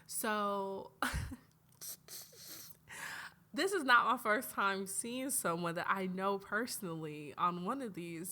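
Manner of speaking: ordinary talk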